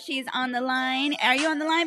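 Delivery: ordinary talk, nothing marked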